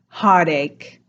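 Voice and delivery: Heartache said as hard ache, T turned to D; New York accent, hard-ache. Shown as a demonstration. Teacher demos the flapped T in Heartache. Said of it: "It's like you're saying hard ache".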